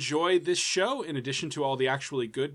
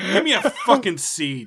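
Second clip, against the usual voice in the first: laughing; 5 semitones higher, 7 dB louder, slower than usual. Real, not acted. Laughing demand for seed.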